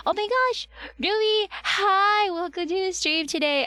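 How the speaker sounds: strained, tired voice. Real, not acted. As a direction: talk through a strained throat. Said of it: "my voice sounds strained".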